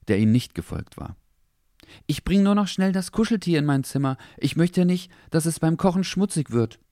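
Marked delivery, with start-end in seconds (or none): 2.1-6.8 put-on higher boy's voice, eager; a boy making a quick excuse; young boy. Henry talks to grandma. (hohe Jungenstimme, eifrig)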